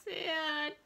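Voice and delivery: bright, expressive picture-book reading; reading to children. Acted. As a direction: read it out to a circle of little kids. Librarian reads picture book aloud.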